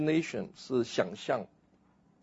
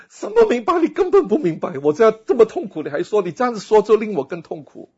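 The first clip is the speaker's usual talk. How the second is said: much higher, louder, fast anguished protest; a hurt mourner lashing out in a high loud cry; grieving outburst; 8 semitones higher, 7 dB louder than usual. Pretend. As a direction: snap back through tears at empty comfort. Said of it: "你哭的时候, 你说".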